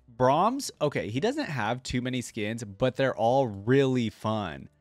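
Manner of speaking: normal talking voice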